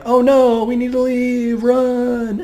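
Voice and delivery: high, hurried mock alarm, a bit breathy; mock panic. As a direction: play the fleeing heroes in a hammed-up panic. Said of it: "and they're like".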